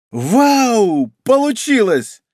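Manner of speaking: high excited cheer. Acted. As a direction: cheer with delight. News of success, character reacts.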